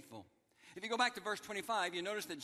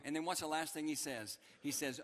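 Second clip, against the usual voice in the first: ordinary talk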